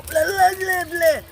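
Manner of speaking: high, loud mocking gibberish voice; sneering high-pitched blah blah. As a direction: parrot the chatter in a silly high squeal. Mocking a crazy chatter.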